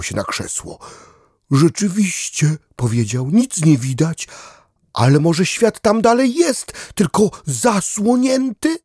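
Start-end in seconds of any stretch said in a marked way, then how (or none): 1.5-2.6 lightly higher puzzled dog voice; a thoughtful little dog, lightly voiced; dog character. Dog at the window agreeing, lightly voiced higher. 3.3-8.8 higher, puzzled dog voice; a small dog musing hopefully; dog character wondering. Acted. Dog to duck at the window, wondering aloud in a higher voice.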